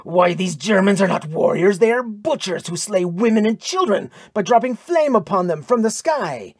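high, loud, raging protest; indignant warrior's outburst; raging denunciation. A narrator's reading. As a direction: spit out the accusation in rage. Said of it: "Tyr's iron-like face was raging".

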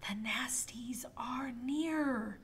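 soft, slow whisper. Acted. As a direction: whisper it with caution. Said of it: "Sir Ned whispered softly".

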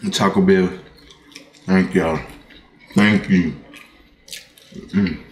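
talking between bites of food; chewing while speaking; mouth full while chewing. Real, not acted. Seen 4: bites at -4.0, mouth working with burrito held through words.

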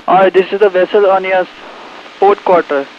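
marine radio. Real, not acted. Ship calls over radio.

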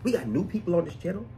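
ordinary delivery

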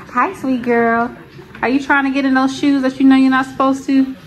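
high-pitched mommy voice. Real, not acted. Mom to toddler getting in the shoes, playful baby talk. Mother talking to her baby.

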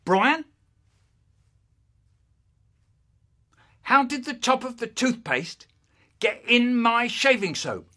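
high, emphatic interrogation; accusing dad. Acted. Dad questioning son about the shaving soap, accusing. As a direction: question him pointedly, phrase by phrase. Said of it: "and he goes".

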